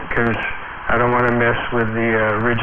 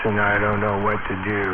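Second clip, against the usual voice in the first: softer, lower, deflated; depressed voice; 5 semitones lower, 4 dB quieter than usual. Shown as a demonstration. Demonstrating his depressed voice to radio buddies, low and mopey. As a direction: let the voice sink and mope. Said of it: "down into the depressed voice".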